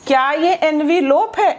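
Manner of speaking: "एनवीलोप" as en-vee-lope; long final vowel in "एनवीलोप"; wrong envelope. Shown as a demonstration. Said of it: "नहीं, L O P E नहीं होगा".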